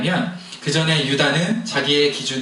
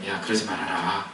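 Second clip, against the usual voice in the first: a soft, lower voice of God restraining him; quiet voice of God; 5 semitones lower, 7 dB quieter than usual. Retold or imitated. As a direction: let God gently hold him back. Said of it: "이렇게 해가지고 제가 이제 참았어요". (조용히 타이르는 하나님 목소리)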